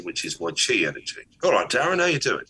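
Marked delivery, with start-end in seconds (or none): 1.4-2.4 higher, eager hello; pitched-up excited welcome; excited greeting. Shown as a demonstration. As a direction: sound thrilled to see him. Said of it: "I'm excited to see you".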